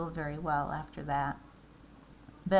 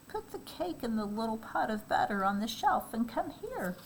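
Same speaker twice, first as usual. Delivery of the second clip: disguised wolf; 6 semitones higher than usual. Acted.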